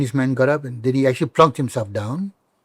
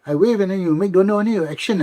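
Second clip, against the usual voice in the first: puzzled friend; 7 semitones higher than usual. Retold or imitated. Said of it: "my friends be like".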